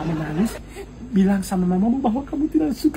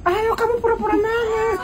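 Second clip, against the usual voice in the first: high fake-whiny voice, pitched way up; mock crying coax; 9 semitones higher, 3 dB quieter than usual. Father coaxing his baby daughter, high mock-whiny voice.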